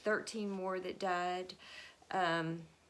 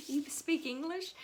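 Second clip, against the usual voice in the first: much higher, astonished; amazed question; 9 semitones higher than usual. Retold or imitated. Pilgrims stunned the visitor speaks English, high amazed question. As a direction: gasp it out in disbelief. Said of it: "And they're like".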